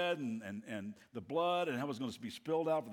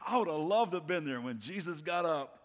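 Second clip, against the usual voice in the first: ordinary talk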